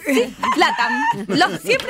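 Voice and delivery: high, laughing delivery of latam; giggling through the word latam. Real, not acted. Laughing at being called latam. Joking about Latin American accents.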